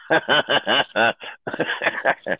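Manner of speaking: real laughter. Real, not acted. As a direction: laugh it out after the story. Laughing after own story.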